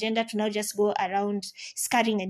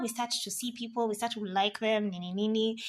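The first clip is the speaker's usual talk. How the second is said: hushed, teasing; 7 dB quieter than usual. Acted. Hushed telling of falling for someone.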